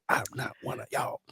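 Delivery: breathy, unvoiced sneer of superiority; snooty hushed aside. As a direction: whisper it like a stuck-up local. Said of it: "Boogie be out there like".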